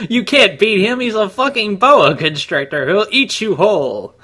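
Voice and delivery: playing Prince Charles, giggling and squeaky; laughing high Charles voice. Prince Charles warns his son, squeaky voice through giggles.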